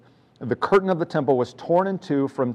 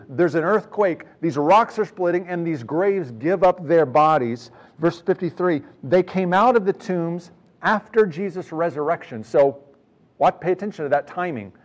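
higher, louder impassioned sermon; fervent preaching; 5 semitones higher, 4 dB louder than usual. Acted. Preacher stressing the miracles to the congregation, impassioned preaching. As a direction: drive it home to the church.

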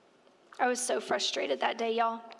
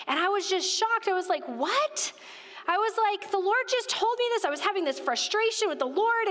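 much higher, excited retelling of shock; 6 semitones higher than usual. Retold or imitated. Telling the church how the fig gift stunned her, pitched up in shock. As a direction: let the pitch leap up in disbelief.

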